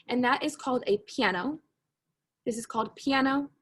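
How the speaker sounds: normal talking voice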